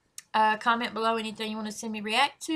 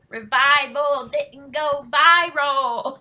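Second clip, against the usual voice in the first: quoting lyric; 4 semitones higher, 8 dB louder, slower than usual. Retold or imitated. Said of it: "That's the song when he said".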